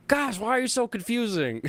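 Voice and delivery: high, teasing exasperated tone; playful exasperation. Real, not acted. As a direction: tease them for confusing you.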